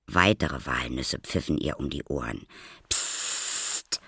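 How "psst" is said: sharp voiceless psst; hissed shush. A narrator's reading. Maya silencing the noisy boy, hissed shush. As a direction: hiss for silence. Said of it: "zischte Maya".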